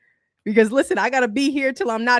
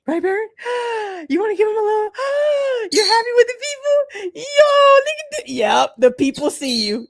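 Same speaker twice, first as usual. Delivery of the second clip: much higher, gasping cooing voice; 4 dB louder than usual. Real, not acted. Talking to her pet bird on camera, high excited baby talk.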